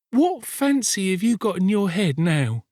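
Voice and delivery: put-on high girl's voice feigning lightness; forced cheer. A narrator's reading. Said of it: "forcing a laugh".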